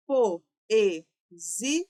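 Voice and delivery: "Poesia" split into po-e-si-a, each part separate; syllable by syllable. Shown as a demonstration. Teacher shows students the division, "Poesia" said syllable by syllable. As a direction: break "Poesia" into four loud, separate syllables. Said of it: "nós temos a seguinte divisão".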